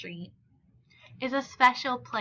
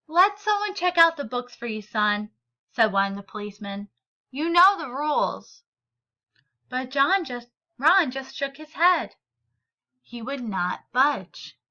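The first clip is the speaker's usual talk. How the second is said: story reading. A narrator's reading. Teacher reads picture book aloud.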